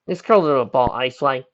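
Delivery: plain reading voice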